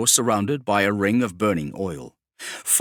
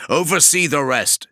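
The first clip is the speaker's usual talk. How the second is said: higher and louder barked battlefield orders; commanding warsmith; 6 semitones higher, 5 dB louder than usual. Acted. Kravarek orders his men to cover the demigod, loud and commanding. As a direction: bark it across the hold to your squads.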